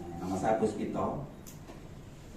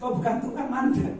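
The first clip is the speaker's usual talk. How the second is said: mock cut-off line; 13 semitones higher, 4 dB louder than usual.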